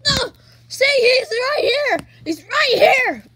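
loud, slow shout of alarm; alarmed yell. Acted. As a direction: yell to warn everyone he's here. Spotting the monster, yelling.

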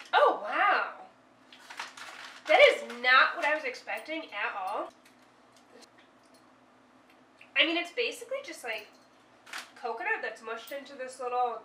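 speaking between bites; talking while chewing. Real, not acted. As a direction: mouth partly full of food. Seen 2: Bites at -4.0 and again at +6.3, then talks while chewing.

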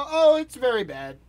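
imitating the casual upbeat answer; breezy "very bad". Mimicking the Moroccan man's cheerful answer about his country, breezy. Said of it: "he didn't drop an oct".

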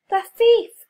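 very loud, high alarmed cry; cried out. A narrator's reading. Boost spotting the man on the platform, loud cry. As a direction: yell it up at the platform. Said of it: "cried Boost".

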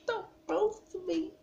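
very high mock-pitiful plea; small-voiced pleading. Repeats the plea more softly.

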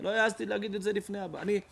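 ordinary delivery

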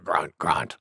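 low, softened boar grunts; two low grunts after the sneeze; grunting boar. A narrator's reading. Wild Boar after her big sneeze, low grunting. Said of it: "said Wild Boar".

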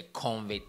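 "covid" with an added n, as CON-vid; mispronounced covid. Shown as a demonstration. Host naming the virus to viewers, mispronounced covid. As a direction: say "covid" wrongly as "convid". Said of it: "It's not covid".

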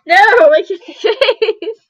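high, slow laughing protest; giggling through the words. Real, not acted. Insisting on kissy face.

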